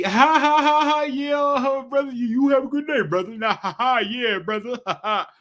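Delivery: high, loud cackling cheer; a hammed-up chipper rich brother laughing; fake happy laugh. Mocking the always-happy rich, high and laughing. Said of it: "they always happy".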